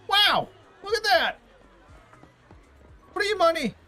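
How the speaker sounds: high, loud excited crowd cries; excited shouting. Acted. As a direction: yell it like a thrilled bystander. Crowd reacts to prize.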